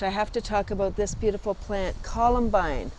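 ordinary talk

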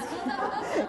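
real laugh at the memory. Real, not acted. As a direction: burst of high laughter.

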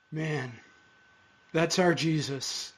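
ordinary talk, nothing marked

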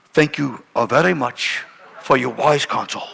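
lightly voiced stately husband; formal thanks. Husband thanking young pastor after the fight, restrained politeness.

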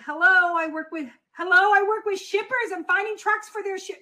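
"Hello" blurted high and loud, then a hurried restart; rushed nervous pitch. Shown as a demonstration. Coach demoing the wrong cold call, rushed and loud. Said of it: "No, that's not how you do it".

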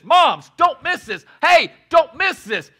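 loud, slow call to the room; shout. Acted. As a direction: wake them up, don't let them miss it. Preacher calling out to audience.

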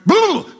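sudden high yelp of rage; mock angry yell. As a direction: burst out in a hammed-up fit of temper. Acted temper outburst.

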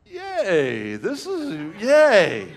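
high, swooping fake yay; grudging mock cheer. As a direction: cheer while secretly annoyed. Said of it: "I'm mad at this point".

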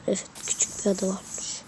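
soft unvoiced whisper. Real, not acted. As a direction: whisper it to yourself. Whispered aside to self.

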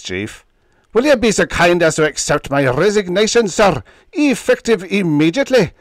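a light Scottish burr, loud and indignant; loud Scottish burr, offended; Scottish indignation. A narrator's reading. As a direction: protest in an accusing Scottish burr. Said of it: "Scottish burr accosted us with accusing indignation".

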